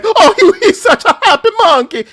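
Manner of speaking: very high, loud, fast cartoon Italian voice; Giuseppe impression. Host mimics Simpsons clip for co-hosts, high cartoon Italian voice. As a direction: squeal it like the Simpsons organ grinder. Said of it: "And he's like".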